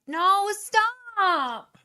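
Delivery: high, loud cry of protest. Real, not acted. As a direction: yelp at her to stop. Friend grabs her Coke.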